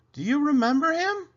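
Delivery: high, loud lady of the house calling; Mrs Rogers voice. Acted. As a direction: call her name up high like the lady of the house.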